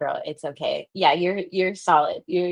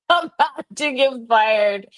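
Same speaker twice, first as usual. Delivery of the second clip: pitched up through laughter; laughing hard; 6 semitones higher, 4 dB louder than usual. Real, not acted. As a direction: get it out while cracking up. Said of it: "I'm laughing my butt off right now".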